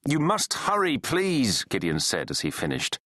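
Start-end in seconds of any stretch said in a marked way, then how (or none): 0.1-1.6 high, pressing appeal; urgent plea. Acted. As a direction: beg the operator for speed. Pleading with the emergency operator.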